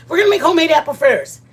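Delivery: high, loud and fast; excited. Real, not acted. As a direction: rush it out with delight. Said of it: "I wanna make apple fritters".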